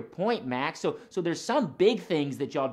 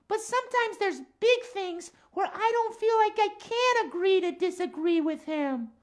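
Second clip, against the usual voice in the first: higher-pitched puppet voice, friendly; lilting cat character voice; Max the cat. Acted. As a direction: play a chatty cat puppet for children.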